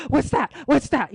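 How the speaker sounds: panicked dad. Retold or imitated. Dad sees the baggie and panics, loud alarmed questioning. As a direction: demand it in a sudden panic. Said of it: "like, panicked".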